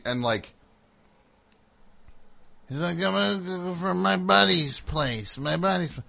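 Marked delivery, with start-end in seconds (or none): 3.0-6.0 put-on higher, hurried excuse from a drunk; lightly voiced drunk stumbling over his excuse; flustered drunk. Drunk stranger excusing himself to the wife, flustered.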